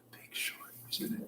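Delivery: barely audible whisper while thinking; whispering to self. Real, not acted. Host trying to recall a movie's cast, whispering to self. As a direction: whisper it privately as you search your memory.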